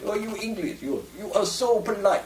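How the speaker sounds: imitated Russian acquaintance. Russian telling the English they are too polite, in a high imitated voice. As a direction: play the Russian teasing, pitched well up. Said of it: "a Russian did say to me".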